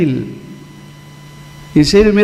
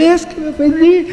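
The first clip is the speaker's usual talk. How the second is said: high-pitched aggrieved whine; mock offended; 12 semitones higher than usual. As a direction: imitate someone upset, voice way up. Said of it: "y todavía se enoja".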